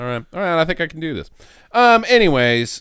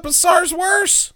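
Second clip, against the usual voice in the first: mock shout; 10 semitones higher, slower than usual. Real, not acted. Punchline shouted for effect.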